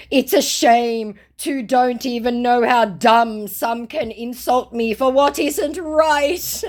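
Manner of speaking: high, slow, drawn-out indignation; hammed-up hurt outburst; mock wounded protest. Scammer complains of being insulted, mock indignation.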